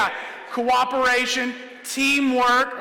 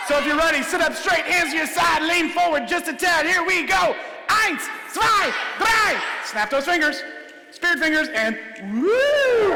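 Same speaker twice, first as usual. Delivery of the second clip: cheerleading the crowd; 6 semitones higher than usual. Acted. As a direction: pump up the kids like a cheerleader.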